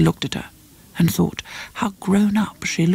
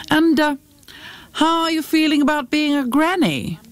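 higher, lightly voiced chatty guest; prying wedding guest; 10 semitones higher than usual. A narrator's reading. Anne asks Jackie about being a granny, lightly prying. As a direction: ask with a lifted, curious lilt.

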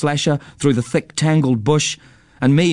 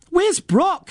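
very high, insistent mother's cry; mother's alarmed high call, acted; shouted question. A narrator's reading. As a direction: insist on an answer, pitch way up. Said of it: "shouted Mum again".

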